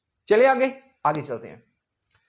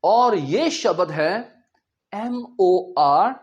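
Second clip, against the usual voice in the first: usual speaking voice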